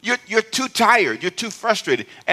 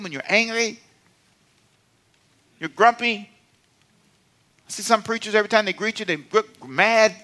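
ordinary talk

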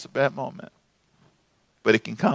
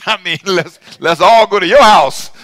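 laughing sarcasm; 5 dB louder than usual. Real, not acted.

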